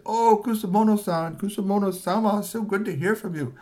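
high, sweet fawning greeting; butter up the boss; fawning subordinate. Acted. Ninja chief greeting his boss on the phone, high and fawning. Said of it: "And Kanji said".

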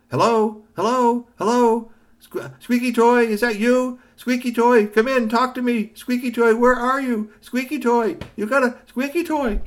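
loud high calling. A narrator's reading. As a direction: call out into the device. Boy calling robot.

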